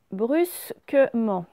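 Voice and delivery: BRUSQUEMENT as BRUS-QUE-MENT; slow, separated syllables in BRUSQUEMENT; sounded out slowly. Shown as a demonstration. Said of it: "on va prendre mot par mot".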